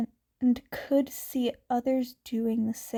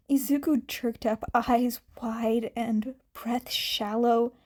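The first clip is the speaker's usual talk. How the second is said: usual speaking voice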